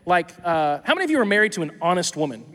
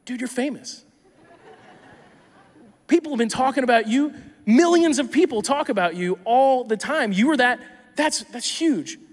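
excited; 5 semitones higher, 3 dB louder than usual. Pretend. Meeting famous boy in heaven.

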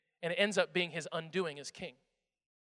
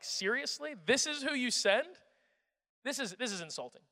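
offended scorn; 6 semitones higher than usual. Acted. Goliath offended by David.

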